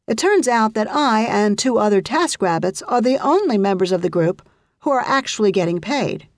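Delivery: normal talking voice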